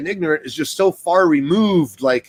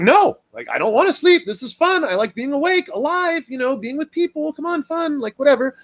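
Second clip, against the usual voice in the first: put-on high, whiny little-kid voice, protesting bedtime; a higher, pleading child refusing to go to bed; whiny toddler; 11 semitones higher than usual. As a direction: play the toddler who won't sleep. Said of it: "they're like".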